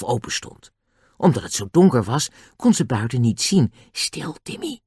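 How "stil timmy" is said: hushed, high whisper. A narrator's reading. Girl hushing her dog in the dark, whispered. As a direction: whisper it softly to hush the dog. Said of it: "fluisterde ze".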